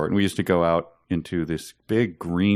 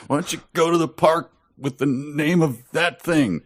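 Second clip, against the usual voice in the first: mock grumpy boss; 7 semitones higher than usual. Mocking the boss's complaint.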